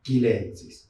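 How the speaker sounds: "chilensis" said as "kilensis"; hard K opening on "chilensis"; Latinized "chilensis", K for CH. Shown as a demonstration. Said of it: "Entonces tenemos que pronunciar".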